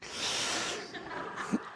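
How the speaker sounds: short breathy laugh, quite high; high airy laugh. Real, not acted. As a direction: laugh softly at the absurdity. Laughing at midlife crisis.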